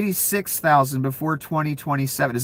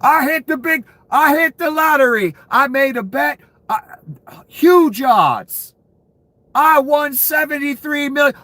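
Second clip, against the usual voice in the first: higher, louder gloating bettor; mock lucky bettor; 9 semitones higher, 3 dB louder than usual. Streamer imitating a bettor who won big. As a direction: play the giddy winner who then realises. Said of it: "Can you imagine being the human that bets on this?".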